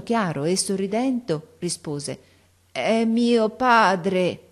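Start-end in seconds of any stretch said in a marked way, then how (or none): 2.7-4.4 very slow, high, loud drawn-out syllables; strange, off-key voice. A narrator's reading. Deaf girl answers the teacher, strange laboured voice. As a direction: pronounce each sound laboriously, as if new to speech. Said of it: "con una voce grossa, strana, stuonata come quella d'un selvaggio".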